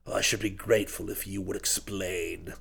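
low, soft, calm politeness; quite polite. A narrator's reading. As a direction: ask politely in a hushed low voice. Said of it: "he said quite politely".

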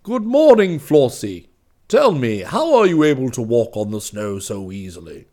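Claus calls out loudly to the deer at his door; calling out. A narrator's reading. Claus hails the deer from his door, loud. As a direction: hail them from the doorway, loud and bright. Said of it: "called to them".

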